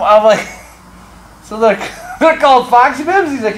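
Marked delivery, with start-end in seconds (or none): 1.4-3.3 high, loud question through laughter; incredulous, laughing retold reaction; amused disbelief. Retelling his amused disbelief at name.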